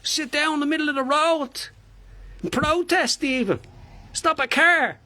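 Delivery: high, incredulous Dublin rant; indignant Dublin busker. Acted. Busker confronting an official over licensing, indignant Dublin voice. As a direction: challenge the official with mounting outrage.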